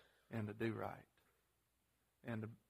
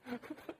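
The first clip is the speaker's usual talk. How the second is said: short high laugh; 10 semitones higher, 3 dB quieter than usual. Real, not acted. Laughs at own story.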